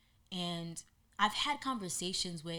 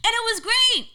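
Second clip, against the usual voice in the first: high, loud burst of joy; thrilled; 15 dB louder than usual. Real, not acted. Telling viewers about her jump, loud and thrilled. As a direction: shout it out, still buzzing from the jump.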